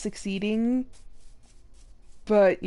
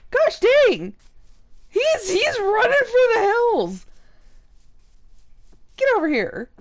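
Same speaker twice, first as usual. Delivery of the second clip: much higher and louder frantic cries; frantic exclaim; 11 semitones higher, 5 dB louder than usual. Real, not acted. Gamer yelling after a fleeing pig, frantic. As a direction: holler after the runaway pig.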